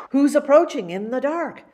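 usual speaking voice

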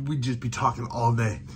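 usual speaking voice

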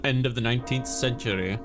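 usual speaking voice

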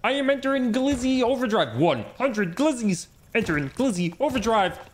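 high, excitable frog puppet voice; Kermit the Frog impression. Streamer announcing Glizzy Overdrive to chat, Kermit impression. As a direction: declare it as the puppet frog, pitched well up. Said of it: "me and Miss Piggy's honeymoon".

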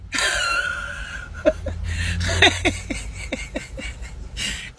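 high, wheezy laughing fit; long airy squeal of laughter. Real, not acted. Host falls out over the wig ambassador comment, laughing.

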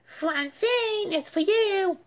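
very high, calling across the house. A narrator's reading. Mother calling Francine to the phone. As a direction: call her to the phone. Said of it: "called her mother".

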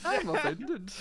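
exaggerated Canadian mimicry, high and laughing; laughing parrot of a Canadian accent; mock Canadian accent. Mimicking an offended Canadian friend, mock Canadian accent. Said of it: "did not exaggerate this much".

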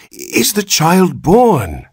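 high, slow pleading question; anxious father begs the doctor; begging. A narrator's reading. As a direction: plead for an answer. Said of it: "begged Mister Button".

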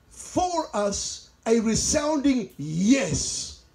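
very slow build to a drawn-out "yes"; resounding YES. Shown as a demonstration. Preacher reads capitalised scripture to the congregation, resounding. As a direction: stretch the phrase out and land hard on "yes". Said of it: "has the word yes in capital letters".

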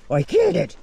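squeaky boastful I killed it; high bragging dog voice. Pretend. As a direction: brag it in the dog's high voice. Dog voice in mock argument.

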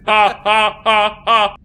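robot laugh. Pretend. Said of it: "laugh in our robot voices".

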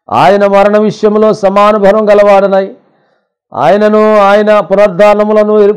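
high, forceful sustained reading; scripture proclamation. Acted. Preacher reading Paul's letter to the congregation, forceful proclamation. Reads epistle aloud.

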